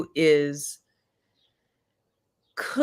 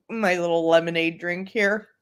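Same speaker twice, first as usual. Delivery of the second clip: ordinary delivery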